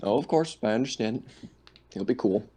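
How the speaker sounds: normal talking voice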